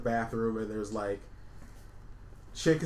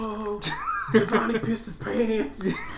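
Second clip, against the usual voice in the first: high, slow laughing mock announcement; laughing taunt; 9 semitones higher, 5 dB louder, much slower than usual. S0 mocking an imagined post about Giovanni, high and laughing. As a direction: cackle through the taunt.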